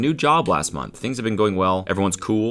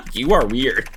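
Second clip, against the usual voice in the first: normal talking voice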